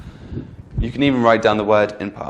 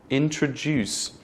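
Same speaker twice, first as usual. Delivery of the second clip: "introduce" slowly, weight on the last syllable DUCE; stress at the end; 3 semitones higher, 4 dB quieter, slower than usual. Shown as a demonstration. Teacher giving an example word, "introduce" slowly end-stressed. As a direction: lean on the final syllable of "introduce". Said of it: "is pronounced with a stress at the end".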